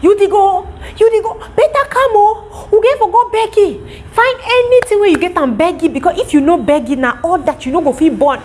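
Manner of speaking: hammed-up urgent scammer calling after them; scammer pleading. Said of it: "The guy was like".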